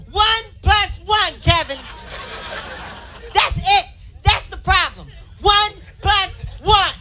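frustrated mom lecturing her son; angry mom voice. Retold or imitated. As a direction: scold the boy over simple math. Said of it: "She's like".

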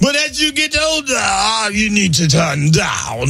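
starts high and drawn-out, then settles; slow hype holler easing down; mock party yell winding down; playful hype shout. Playful hype voice answering the host.

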